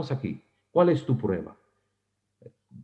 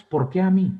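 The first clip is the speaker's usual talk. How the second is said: complaining why me; 7 semitones higher than usual. Retold or imitated. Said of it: "tú te preguntas".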